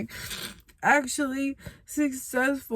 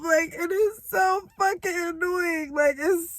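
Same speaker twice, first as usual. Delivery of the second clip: high, tearful and breaking; crying; 6 semitones higher than usual. Real, not acted. Tired of having nobody to help her, crying. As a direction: sob through each phrase.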